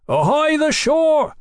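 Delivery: high, drawn-out hail; loud sailor's hail, pitched high; shouting. A narrator's reading. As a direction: shout up to the shore. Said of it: "Mallory shouted".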